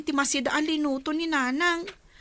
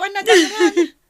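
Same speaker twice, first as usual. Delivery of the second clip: crying out; 2 semitones higher, 10 dB louder than usual. Acted. Upset over bleeding face.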